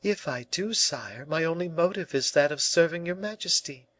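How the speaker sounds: put-on high, earnest girlish voice; noble-hearted girl. A narrator's reading. La Valliere declares her loyalty to the king, earnestly. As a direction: answer high and sincere. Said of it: "replied the noble-hearted girl".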